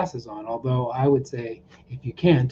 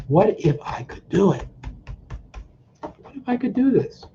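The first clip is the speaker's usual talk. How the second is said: much higher, light hopeful voice; hopeful wondering; 6 semitones higher than usual. Shown as a demonstration. Coach dreams aloud while tapping, hopeful and higher. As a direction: dare to imagine you could do it. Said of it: "So the dreaming would be".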